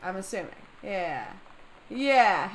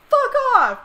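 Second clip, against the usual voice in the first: loud high shout; loud, high, shaken fuck off; startled curse; 12 semitones higher, 11 dB louder than usual. Real, not acted. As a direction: yell it out of fright. Reacting to the scare.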